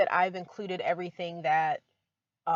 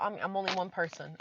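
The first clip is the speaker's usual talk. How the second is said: ordinary talk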